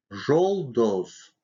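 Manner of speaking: Жолдоз with both vowels rounded like O; rounded second vowel. Shown as a demonstration. Teacher showing how О affects the next syllable, Жолдоз rounded. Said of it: "последние звуки редуцируются и произносятся".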